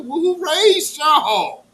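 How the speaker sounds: loud, very slow exasperated scold. Real, not acted. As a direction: drag out the disbelief at them.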